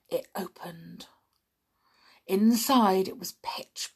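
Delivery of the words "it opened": unvoiced, hushed; tense whisper. A narrator's reading. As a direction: breathe the words out. Tense discovery.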